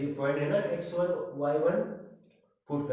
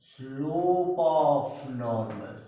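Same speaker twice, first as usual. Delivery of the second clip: very slow, louder, every word stretched: "slope of normal"; stressed phrase; 4 dB louder, much slower than usual. Shown as a demonstration. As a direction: drag out "slope of normal" to make the point land. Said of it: "मगर question में क्या दिया है". ("slope of normal" को बहुत धीरे, खींचकर और ज़ोर से बोलें)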